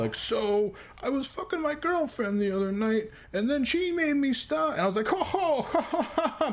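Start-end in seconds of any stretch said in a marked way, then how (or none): 0.1-4.7 mock comic. Teller mocking a bad comic's set-up in a put-on voice. 5.1-6.5 obnoxious fake laugh. Heckler mocking bad comics with an obnoxious fake laugh. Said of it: "I was laughing really obnoxiously like that".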